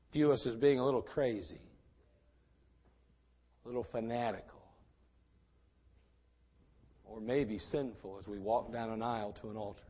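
low, soft, with long pauses; hushed, measured reflection; sombre preaching. Real, not acted. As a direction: confide the hesitation quietly.

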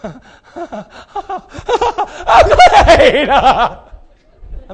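delirious laughing cry of joy. Acted. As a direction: shout it through giddy laughter.

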